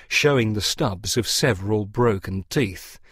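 usual speaking voice

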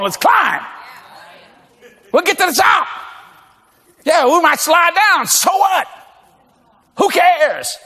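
rally the climbers, shouting it up; rousing mock pep talk. Acted pep talk up the mountain.

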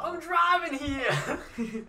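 slow, high, loud Jersey tough guy; Jersey accent. Shown as a demonstration. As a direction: lean into a mock Jersey yell. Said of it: "my Jersey accent".

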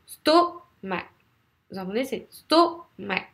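"stomac" slowly split, STO strong and mac dropped; stress on first syllable. Shown as a demonstration. Teacher insisting on purpose for students, "stomac" stressed on STO. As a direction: hit STO in "stomac", let mac fall away. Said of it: "J'insiste exprès".

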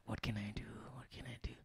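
panicked whisper to yourself. Retold or imitated. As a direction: whisper it under your breath. Inner panic before going live.

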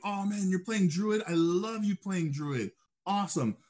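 high, gushing praise; enthusiastic praise. Retold or imitated. Leader cheering a teammate playing Druid, high and gushing. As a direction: hype up the Druid player. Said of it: "So you go and you be like".

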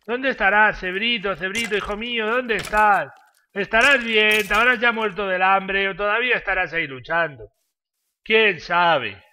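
high, loud mock wailing; hammed-up lament. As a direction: wail for a lost pet, overdone. Mock calling out for lost pet.

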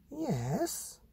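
high breathy mum voice; mum's voice. Acted. Mother explaining real animals, high airy voice. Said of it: "said Mum".

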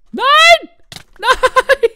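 loud high scream of "Nein!"; despairing shout. Real, not acted. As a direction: yell it out in shock.

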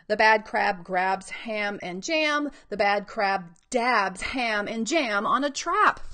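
slow, loud, measured picture-book reading; reading to children. Acted. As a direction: give each word its own weight. Reads book aloud.

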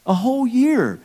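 "A whole year" shouted high and loud, YEAR stretched; loud, high emphatic repeat. Acted. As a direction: belt out "A whole year" with disbelief.

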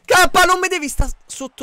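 high, loud angry shout. Real, not acted. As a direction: snap at teammate. Yelling at teammate in game.

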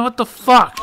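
exasperated outburst. Real, not acted. Gamer stuck on a puzzle.

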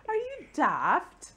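slow, high mock English accent; exaggerated English-accented question; imitated English accent. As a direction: mimic a little English accent, drawn out. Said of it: "had that little English accent going on".

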